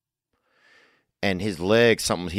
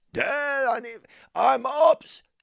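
high voice; slower than usual. Pretend. Kid calls, dad answers.

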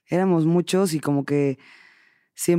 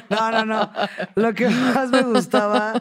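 high, laughing through the words; 5 semitones higher, slower than usual. Real, not acted. Guest taking back her joke answer to the host, laughing. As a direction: try to answer while cracking up, words stretched.

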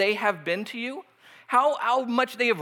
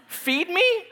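mock disbelief; 8 semitones higher than usual.

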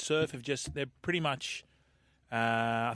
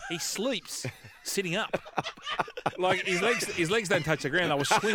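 laughing; 5 semitones higher than usual. Real, not acted. Teasing a co-host about his height.

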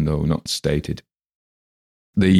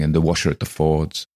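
ordinary talk

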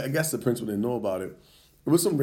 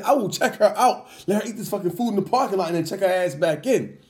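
higher, heated yelling; retold angry shout; 6 semitones higher than usual. Father telling the teacher he'll check his kid out, heated shout. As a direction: push the threat out hot.

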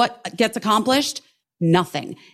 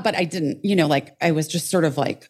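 normal talking voice

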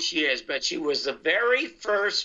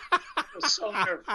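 laughing; 3 semitones higher than usual. Real, not acted. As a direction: still laughing through the words. Still laughing after the memory.

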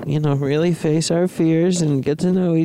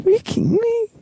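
shrieked catchphrase; faster than usual. Acted. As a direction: squeal the line in disbelief. Running bit, shrieked.

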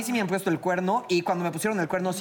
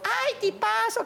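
much higher, slow swooning sigh; mock swooning; 13 semitones higher, much slower than usual. [name] mimicking coworkers gushing over the actor, high and slow. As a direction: gush like a starstruck fan.

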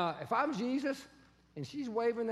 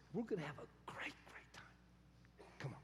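hushed, breathless promise; whisper; 8 semitones lower, 17 dB quieter than usual. Acted. Jesus inviting Martha in, whispered. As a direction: whisper it like a secret.

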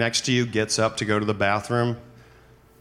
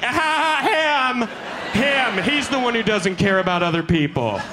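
high, laughing mock outrage; accusing passenger; 10 semitones higher than usual. Passenger calling out the man who won't remove headphones. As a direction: point him out like a tattletale. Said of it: "I'm like".

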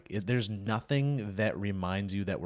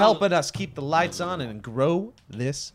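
high, slow, celebratory announcer delivery; hammed-up sponsor thanks; 8 semitones higher, 4 dB louder, slower than usual. Host thanking the sponsor for keeping lights on, hammed-up. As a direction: stretch the thanks out grandly.

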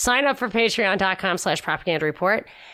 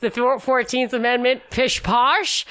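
higher, mocking pish posh; mock posh British; 5 semitones higher than usual. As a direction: ham up a stuffy Brit, lightly. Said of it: "made fun of him by saying".

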